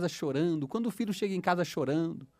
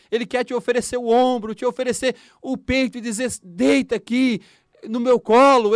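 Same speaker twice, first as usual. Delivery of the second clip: loud, higher fervent preaching; preaching at full force; 4 semitones higher, 6 dB louder than usual. Acted. Preacher urging listeners to accept God's comfort, fervent and loud. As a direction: urge them to open their hearts.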